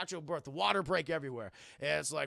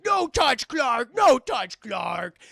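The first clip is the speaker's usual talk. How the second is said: high, loud, broken-grammar yells; shouting caveman Superman; 5 semitones higher, 12 dB louder, much slower than usual. Acted. Held down by heroes, Superman shouts at them. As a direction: fend them off like a cornered brute. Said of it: "He's like".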